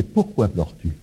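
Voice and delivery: soft, low, caring question; tender question. Retold or imitated. Risen Jesus asking Marie, tender and hushed. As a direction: ask gently, as if leaning close.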